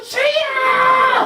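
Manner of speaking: very high, loud, drawn-out shout; long full-voiced yell; shouted prayer cry. Shown as a demonstration. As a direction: belt out a desperate call to God. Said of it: "막 이렇게".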